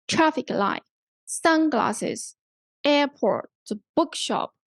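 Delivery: "traffic", "sun", "air", "book" stressed, second parts falling away; first-part stress. Shown as a demonstration. Teacher showing students compound word stress, first parts of "traffic light, sunglasses, airport, bookshop" stressed. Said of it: "the stress is on the first part of the word".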